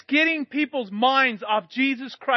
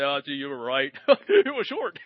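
sheepish admission; 8 semitones lower than usual. Retold or imitated. Glazier admitting to the customer she was right, laughing. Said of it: "humble myself and say".

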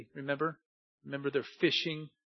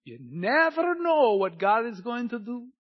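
raised, loud, swelling proclamation; building wonder; 6 semitones higher, 5 dB louder than usual. Real, not acted. Preacher stirring church to expect God's work, raised wondering proclamation. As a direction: lift it high and loud, full of anticipation. Repeats the line in contrasting deliveries.

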